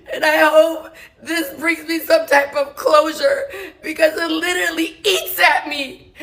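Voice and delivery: tearful, laughing through tears; crying. Real, not acted. Daughter tells how her father never came, crying. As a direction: voice breaking with real tears over her father.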